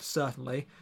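ordinary delivery

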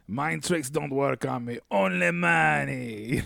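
slow, drawn-out mock Watto accent. Quoting Watto's line to show the accent, slow mock Watto accent. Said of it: "the line with the accent".

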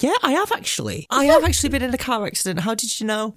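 high-pitched mock surprise; playing along. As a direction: play along with the scam caller, pitched high. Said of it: "I like to play along".

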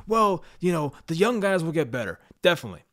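sarcastic impression of an optimistic fan; sarcastic mock voice. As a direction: parrot the hopeful fans with a sneer. Mocking optimistic fans' excuse.

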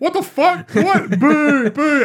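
very high, loud indignant booing; mock crowd outrage. Students hearing Quidditch is cancelled, loud high booing. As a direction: boo like an angry crowd. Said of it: "And everyone's just like".

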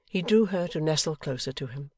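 ordinary delivery

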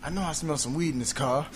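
ordinary talk

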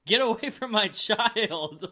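slow, alarmed parent warning; a hint of a scared mother; protective parent. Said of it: "parents to be like".

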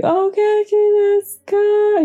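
very high, loud cry of Genki desu ka; hammed-up dramatic movie scream; mock screaming. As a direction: scream it into the wind like a movie heroine. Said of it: "She says, like, four times in the wind".

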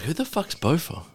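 "Who the fuck's Bofa?" asked slow and high, baffled; mock bewildered. Recalling the prank, baffled and slow. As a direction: play the confused friend hearing a strange name. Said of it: "I was like".

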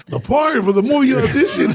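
high, amused imitation of a caller; imitating insistent caller. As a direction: retell the caller spelling out the obvious. Acting out the casting caller's clarifying reply.

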